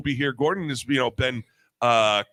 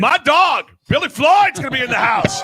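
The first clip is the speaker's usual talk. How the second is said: hype announcer; 13 semitones higher, 8 dB louder than usual. Acted. Hyping up next week's guest.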